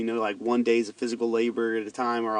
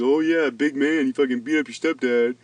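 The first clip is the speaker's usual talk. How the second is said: mock critic. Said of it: "I'm not the type of dude".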